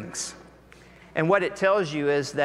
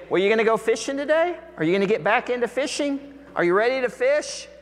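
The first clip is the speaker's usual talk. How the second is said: teasing townsfolk; 7 semitones higher, faster than usual. Retold or imitated. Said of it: "imagine other people saying".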